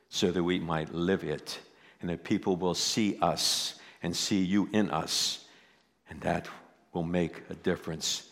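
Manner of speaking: soft, low closing prayer. Real, not acted. Pastor closing in prayer, soft and low. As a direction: pray quietly over the congregation.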